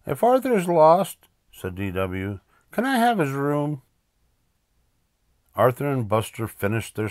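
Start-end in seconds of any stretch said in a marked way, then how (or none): 0.1-3.8 put-on high, loud-starting small-girl voice; eager little sister. Little sister to Mom about brother's room, eager. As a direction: pipe up high with a cheeky request.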